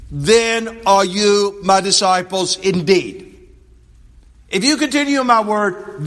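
loud, high, ringing preacher's reading; forceful scripture proclamation. Acted. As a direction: declare the verse to the whole congregation. Reads and expounds gospel verse.

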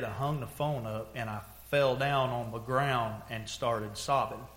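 ordinary delivery

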